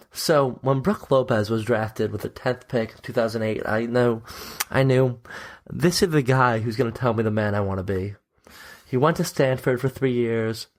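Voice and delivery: low, solemn tribute delivery; put-on gravity, like a eulogy; somber voice. Host honouring his favourite departed player, mock somber and low. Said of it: "get in my somber voice".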